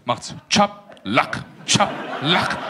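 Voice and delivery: Chablak low and slow, then a voiceless Chablak; sandal-flap sound. Shown as a demonstration. Explaining Afghan word Sandalen, mimicking the Chablak flap sound. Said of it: "Sandalen heißt bei uns Chablak".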